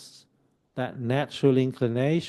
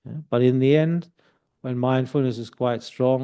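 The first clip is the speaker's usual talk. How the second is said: ordinary delivery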